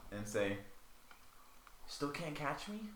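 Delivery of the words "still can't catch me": teasing call. Acted. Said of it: "you hear in her voice call out".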